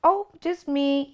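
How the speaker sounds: high, slow lilting answer; sing-song. Retold or imitated. She tells her friend she is the one going, slow and sing-song. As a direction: say it lightly like a little tune. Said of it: "I'm still sing-songing with him".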